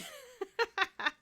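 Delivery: high laugh. Real, not acted. Laughing at annoyance.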